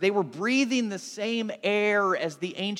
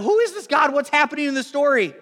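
much higher, louder eager questions; stoked listener; 8 semitones higher, 7 dB louder than usual. Retold or imitated. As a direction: burst with excitement to hear more. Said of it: "he's super stoked and he's excited".

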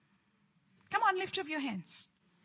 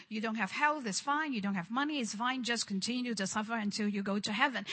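lower, softer, shrugging resignation; mock defeatist preaching; 5 semitones lower, 6 dB quieter, faster than usual. Preacher mocking the religious spirit's message, softer and lower. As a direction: mimic a false teaching, softly. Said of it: "the religious spirit twisted it".

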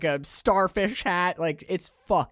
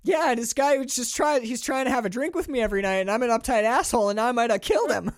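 fast, high, panicked self-blame; frantic guilty rant; 8 semitones higher, 4 dB louder, faster than usual. Retold or imitated. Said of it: "he's like".

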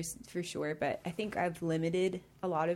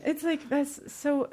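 fighting back tears, higher and louder; choked up; 7 semitones higher, 6 dB louder, slower than usual. Real, not acted. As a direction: struggle to carry on through tears. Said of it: "It's getting me".

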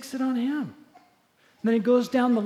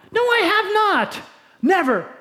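furious denial; 11 semitones higher, 7 dB louder than usual. Acted. Andy flatly denying adultery to Jesus, high and loud. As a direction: refuse it outright.

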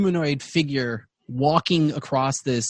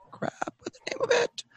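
normal talking voice

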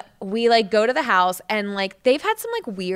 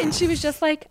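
ordinary talk

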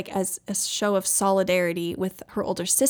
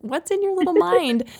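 put-on high, louder gush to a toddler; pitched-up, adoring parent voice; fond amazement; 10 semitones higher, 5 dB louder than usual. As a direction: coo in wonder at a little kid. Mother cooing at toddler in quote.

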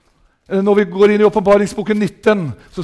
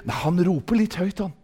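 hushed, mildly disapproving mock complaint; soft imitation of offended churchgoers; imitated grumblers; 10 dB quieter than usual. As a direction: mimic prim complainers under your breath. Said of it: "Det er noen som sier".

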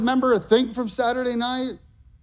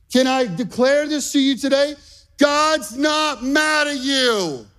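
slow, loud, higher preaching build; preacher declaring God's not mad at you, slow and rising; full-force preaching; 6 semitones higher, 3 dB louder, slower than usual. Acted. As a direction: drive each line home to the congregation. Preaching climax.

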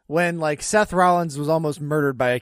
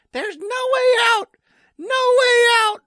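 imitated high-pitched yell; Stephanie; 6 dB louder than usual. Co-host imitating her at the cage door, shrill yell. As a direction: shriek it, much higher and louder than usual. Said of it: "you just hear Stephanie yell".

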